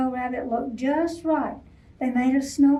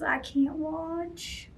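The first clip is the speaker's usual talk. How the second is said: slow, softer fretting; worried owl; 3 semitones higher, 7 dB quieter, much slower than usual. Acted. Owl worrying for Rabbit, slow and soft. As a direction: fret quietly from the tree.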